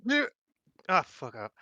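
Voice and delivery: usual speaking voice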